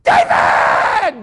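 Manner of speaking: loud yell of the name; screamed name. Retold or imitated. Wife screaming for her husband in the night, loud yell. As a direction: call him as loud as you can. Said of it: "she screams".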